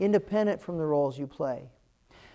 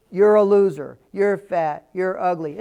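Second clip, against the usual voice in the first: louder mock taunting, punching each insult; loud taunts; 8 dB louder than usual. Quoting the little vandals, louder mock taunts. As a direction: fling the insults like a bully.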